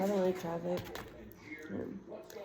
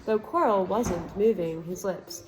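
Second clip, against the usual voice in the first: ordinary delivery, nothing marked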